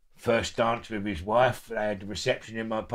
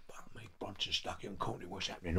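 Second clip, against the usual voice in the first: low, hushed prisoner chatter; hushed gossip; 3 semitones lower, 14 dB quieter than usual. Retold or imitated. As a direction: whisper it like gossip.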